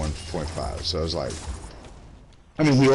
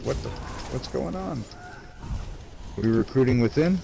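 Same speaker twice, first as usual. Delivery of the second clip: surprised; 6 semitones higher than usual. Retold or imitated. Surprised at guild recruiting post.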